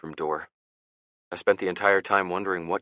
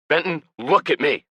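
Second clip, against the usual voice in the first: sudden high, loud yell; 10 semitones higher, 8 dB louder than usual. A narrator's reading. Older brother yelling at grieving Ben, loud shout. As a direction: snap him out of bed with a shout. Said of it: "Ian never yells at me".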